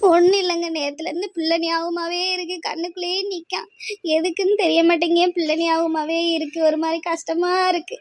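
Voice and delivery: crying while explaining; tearful grief. Acted. Wife telling husband she misses her child, crying. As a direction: confess the ache through tears. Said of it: "இப்படி அழுது அழுது".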